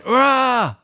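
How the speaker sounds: loud high whoop as if leaping out; hammed-up surprise yell; a shrieked boo-style cry to scare passers-by; mock jump-scare shout. Naked Dave jumping from bush.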